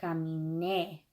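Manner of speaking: "caminé", weight on the last syllable; final-syllable stress. Shown as a demonstration. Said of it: "really hear me how I say caminé".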